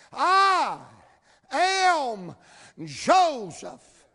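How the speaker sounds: loud, high, drawn-out proclamation; Joseph's grand reveal. Acted. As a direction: announce who you are, word by word. Joseph reveals himself to brothers.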